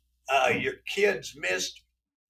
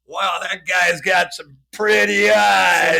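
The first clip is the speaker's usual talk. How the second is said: loud, slow, drawn-out awe; hammed-up wowed reaction; mock admiration; 8 dB louder, slower than usual. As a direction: gush slowly and loudly like an impressed onlooker. Acts out admirer seeing masked face.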